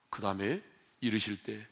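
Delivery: ordinary delivery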